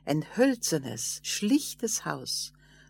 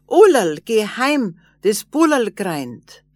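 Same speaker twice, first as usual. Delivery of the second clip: calling out loud; 4 semitones higher, 8 dB louder than usual. A narrator's reading. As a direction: call her home at full voice. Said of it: "Da kam ihr Mann und schrie".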